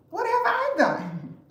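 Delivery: high, slow, wide-eyed what have I done; mock innocent surprise. Real, not acted. Sounding innocent to the men who fear her. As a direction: play the hurt innocent.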